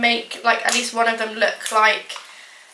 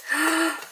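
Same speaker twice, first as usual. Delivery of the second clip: a sharp, higher, soft gasp of surprise; delighted gasp; 6 semitones higher, 4 dB quieter than usual. Real, not acted. Seeing the treat come out right, a gasp. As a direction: catch your breath in delight. Reacting to the result.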